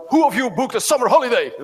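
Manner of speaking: loud and high, barking it at the recruits; mock officer bellowing a question; drill officer's shout. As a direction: call it out across the barracks like an officer. Drill lieutenant addressing new recruits.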